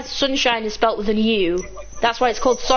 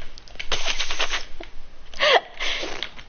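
laughing; 5 dB quieter than usual. Real, not acted. Laughing at friend's selfish joke.

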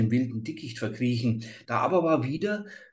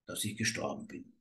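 last breath; 5 semitones lower, 11 dB quieter, faster than usual. A narrator's reading. Father's last words.

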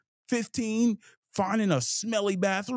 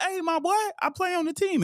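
calling out to the bus driver; 14 semitones higher than usual. Retold or imitated. Player flagging down the city bus driver, calling out high. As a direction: wave the driver down, pitched high.

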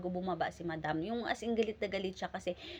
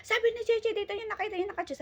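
much higher, accusing; mother throwing the maid's word at her son; hammed-up angry mom; mock shouting mother; 13 semitones higher than usual. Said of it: "nagsigawan sila".

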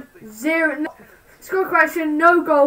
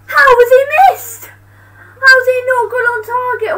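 loud high disbelieving shout; frustrated outburst; 8 semitones higher, 7 dB louder than usual. Real, not acted. Fan yelling at his player over a missed shot, exasperated. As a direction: yell at the screen over the miss.

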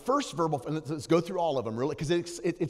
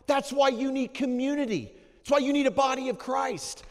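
loud, high fervent preaching; full-force preaching; 10 semitones higher, 7 dB louder than usual. Acted.

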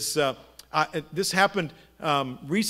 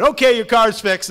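higher, much louder imitated mechanic; loud interrupting announcement; 5 semitones higher, 11 dB louder than usual. Mechanic calling to waiting customer, loud and abrupt. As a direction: break the mood with a loud call-out. Mechanic breaks in on tender moment.

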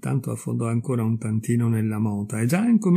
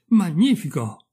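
higher, drawn-out exclamation; exultant cry; 6 semitones higher, 3 dB louder, slower than usual. A narrator's reading. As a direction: savour the word with delight. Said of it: "gridò il razzo". (grido esultante e prolungato)

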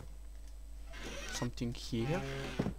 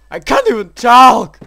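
loud, higher, rattled outburst; breathless shout; 8 semitones higher, 19 dB louder than usual. Real, not acted. Player too shaken to talk, loud breathless outburst. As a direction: blurt it out still out of breath. Still shaken after scare.

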